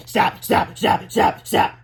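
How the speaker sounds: short, loud, evenly punched words; sharp snaps. A narrator's reading. Boys frantically snap fingers, loud staccato words.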